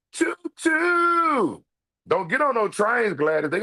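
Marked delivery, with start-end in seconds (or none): 0.1-1.6 high shouted train whistle; playful shouted train call; choo choo. Pretend.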